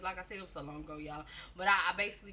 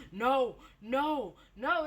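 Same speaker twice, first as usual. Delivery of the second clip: pushed louder, higher no; 5 semitones higher, 5 dB louder, much slower than usual. Retold or imitated. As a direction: insist harder each time. Boyfriend refuses again.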